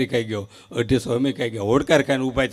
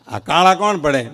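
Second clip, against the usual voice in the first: usual speaking voice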